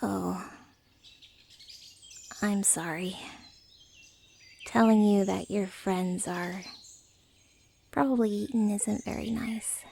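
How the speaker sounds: subdued, sheepish sorry with hesitant pauses; gentle apology. Acted. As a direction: realize you upset them and gently back off. Realizes it upset the listener, apologizes.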